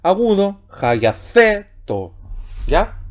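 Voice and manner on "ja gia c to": "ja, gia, C, to" slowly, stress on C as "theh"; acute accent stress. Shown as a demonstration. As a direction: punch and stretch "C", then drop lightly onto "to". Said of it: "entonamos el acento agudo".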